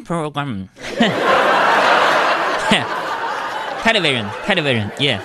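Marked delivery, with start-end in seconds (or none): 0.7-3.8 burst of laughter. Real, not acted. Laugh after TV line.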